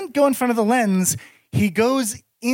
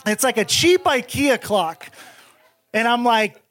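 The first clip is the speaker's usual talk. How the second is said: usual speaking voice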